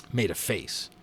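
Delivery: plain reading voice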